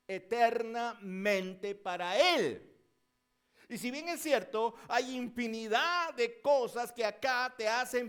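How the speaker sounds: loud, driving sermon voice that swells and stretches key words; fiery preaching. Acted. Preacher urging the congregation they are worth something, full force.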